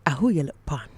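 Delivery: low, quiet in-character greeting. Acted. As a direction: greet him in the show character's voice. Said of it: "I have to greet you in a certain way this week".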